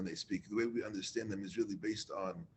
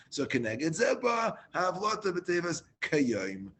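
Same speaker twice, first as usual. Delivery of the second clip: slow, higher and louder, leaning on Kayim; emphasis on Kayim; 6 semitones higher, 6 dB louder, slower than usual. Shown as a demonstration. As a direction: draw out the phrase and land hard on Kayim. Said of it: "he has to emphasize the word Kayim".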